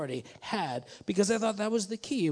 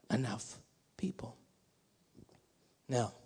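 whispered; 6 semitones lower, 10 dB quieter than usual. Real, not acted. Preacher drops voice for effect.

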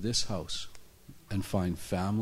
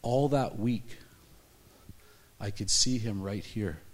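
ordinary talk, nothing marked

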